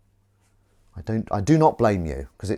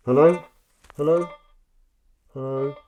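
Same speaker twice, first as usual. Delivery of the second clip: higher, louder rising hello; questioning hello; 4 semitones higher, 4 dB louder than usual. Real, not acted. Checking if it works, a loud rising hello. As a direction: call out testing.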